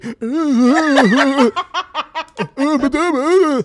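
big loud cackle; loud laugh. Real, not acted. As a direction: laugh out loud at your own joke. Laughs at own joke.

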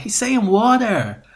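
high, slow American "water"; imitated American accent. Waitress translating Brit's word for colleagues, high American accent. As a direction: draw out "water" in mock American. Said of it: "she was like".

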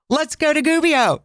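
high-pitched, eager retold exclamation; excited group cheer. Two travelers jump at the idea of Gubbio, excited. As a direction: sing out the suggestion with mock-giddy enthusiasm.

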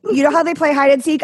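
usual speaking voice

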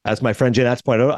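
usual speaking voice

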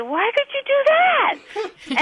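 high, slow, stunned disbelief; reliving the moment she got chopped in the neck; shocked and hurt. Retold or imitated. Telling the host how she reacted to being chopped, high and slow in shock. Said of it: "And I said".

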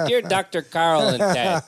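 slow, drawn-out Chicago voice; Chicago accent like Elwood Blues. Shown as a demonstration. Host reads the letter opening in a Chicago accent. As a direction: lean into the Blues Brothers Chicago drawl. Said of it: "talk like El-, Elwood Blues".

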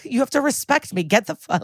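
high-pitched, indignant demand; snippy. Retold or imitated. Aunt demanding respect from her niece, snippy. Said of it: "I got kinda snippy".

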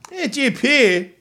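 high-pitched cooing to a cat; pet talk. Real, not acted. Podcaster answers his chatty cat, high pet talk.

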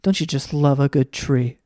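hushed, gentle painter murmur; soft Bob Ross voice. Retold or imitated. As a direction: coo over the trees like a soothing TV painter. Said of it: "He's like".

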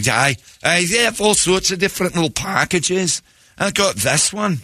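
lightly put-on Scottish fan, high and proud; a cheerful Glasgow lad showing off his packages; Scottish accent. Scottish fan bragging about his ticket packages, in a light Scottish accent.

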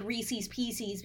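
Reese's Pieces said as REE-seez PEE-seez; both words of Reese's Pieces rhyme on EEZ; imitated pronunciation. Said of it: "because they say it like".

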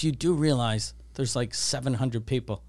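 low, quiet, measured warning; grave warning. Retold or imitated. Manager warning drunk customer about seven hundred people, grave warning.

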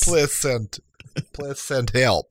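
pleading broken-English Icelandic voice; mock Icelandic accent. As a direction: beg for rescue in a jokey Icelandic accent. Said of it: "I try to do Icelandic".

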